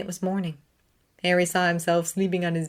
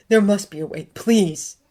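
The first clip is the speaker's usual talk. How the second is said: spent tearful plea; 3 semitones higher, 6 dB louder than usual. A narrator's reading. Severus repeating his plea, worn out crying. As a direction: let the begging sink. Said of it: "Severus was a mess".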